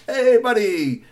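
high, slow drawn-out hey; cheerful vendor. Acted. Vendor greeting the boy, slow high hello. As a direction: stretch the greeting warmly.

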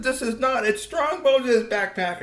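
put-on high, drawn-out child troll protest; defiant retort. A narrator's reading. Girl to teen troll over the backpack, defiant. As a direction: argue back high, stretching the words.

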